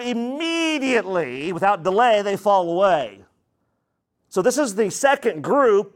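normal talking voice

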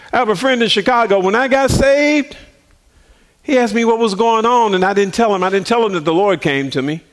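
normal talking voice

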